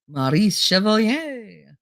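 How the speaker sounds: very slow, drawn-out French flourish; French accent. Acted. Naming a French star for the stream, in a French accent. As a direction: draw the name out in hammy French. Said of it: "all you're gonna get at a French accent".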